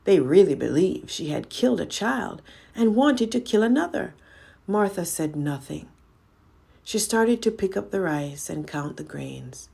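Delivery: ordinary delivery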